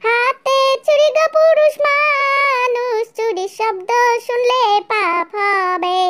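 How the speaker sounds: slow, high, loud sanctimonious scolding; preachy elder. Acted. Pious mother-in-law lecturing the groom's side, slow and loud. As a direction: drag out each word like a pious aunt.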